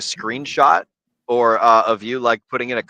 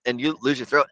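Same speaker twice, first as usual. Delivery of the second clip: normal talking voice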